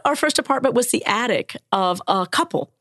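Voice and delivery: ordinary delivery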